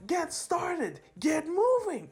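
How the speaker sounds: high-pitched rallying cry; urgent urging. Retold or imitated. Hero urged to get going, urgent high call. Acted urging in retold scene.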